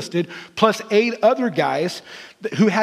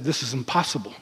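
hushed confession; 8 semitones lower, 7 dB quieter than usual. Acted. Disciples confessing weakness.

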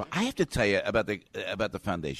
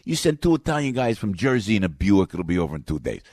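Jersey Italian joke voice; 6 semitones higher, 5 dB louder than usual. Retold or imitated. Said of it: "I'll say, for example".

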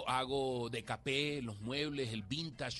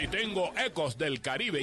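ordinary talk

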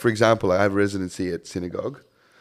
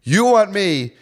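louder, higher, slow retold telling-off; mock rant; 6 semitones higher, 4 dB louder than usual. The DJ retells telling off an entitled woman, loud and slow.

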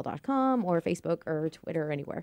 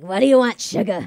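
lightly raspy diner waitress voice; 4 semitones higher, 11 dB louder than usual. Pretend. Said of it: "she better have a raspy voice".

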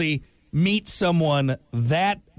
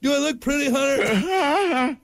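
mock vain patient; 8 semitones higher, slower than usual. Mocking botched cosmetic surgery.

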